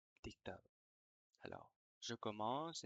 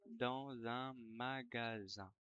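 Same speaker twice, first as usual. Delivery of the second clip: dictating. Shown as a demonstration. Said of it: "je vais dicter une dictée".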